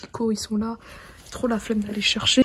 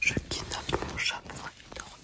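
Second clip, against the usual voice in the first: quiet night whisper; 11 dB quieter than usual. Real, not acted. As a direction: keep it to a hush. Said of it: "donc je chuchote".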